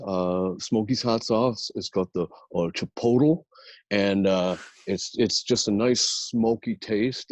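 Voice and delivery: hammed-up Michigan salesman with filler pauses; Michigan accent. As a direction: pitch it in a Michigan accent, stretching phrases. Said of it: "I'll do the Michigan accent".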